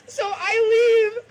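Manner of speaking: loud, slow, tearful shout; half-crying shout of leaving; upset shout. Real, not acted. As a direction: cry it out as you storm off. Storming out after being yelled at.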